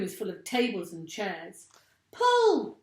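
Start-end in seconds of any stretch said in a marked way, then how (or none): 2.1-2.8 very high, loud yell; yelled order. A narrator's reading. Mugglewump urging the animals, high loud yell. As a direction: yell it like a frantic boss. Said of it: "yelled Mugglewump".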